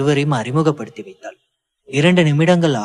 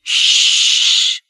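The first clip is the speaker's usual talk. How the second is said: sharp shush; 6 dB louder, much slower than usual. Acted. Simmon silencing Lilly after slap.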